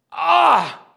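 high piercing demon shriek; far-off inhuman wail; demon cry. Acted. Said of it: "That's what it did. That's a demon".

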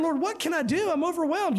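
high-pitched acted helplessness; hammed-up cry for help in prayer; mock overwhelmed plea. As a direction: play someone at a loss, pitched well up. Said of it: "when there's nowhere to turn".

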